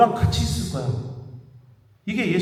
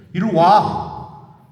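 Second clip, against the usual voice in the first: slow, louder stern summons; firm call; 6 dB louder, much slower than usual. Retold or imitated. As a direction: call him over, drawn out and firm. Retold pulling seminarian aside sharply. (느리고 크게 부르는 단호한 목소리)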